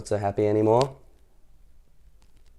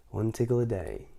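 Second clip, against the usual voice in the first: softer sly repeat of One tickle a day; sly murmur; 8 dB quieter than usual. Acted. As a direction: murmur it with a scheming smile. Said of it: "he thought".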